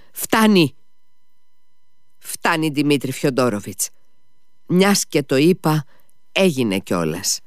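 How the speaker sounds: high sharp cut-off; interrupting lady. A narrator's reading. The lady cutting Mitya off, thrilled with her charity. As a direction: cut him off, delighted with herself. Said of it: "τον διέκοψε η κυρία Χοχλάκοβα".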